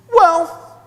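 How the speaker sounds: put-on high-pitched tearful child; whimpering. A narrator's reading. Crying girl answering her classmate, whimpering. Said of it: "Anna whimpered".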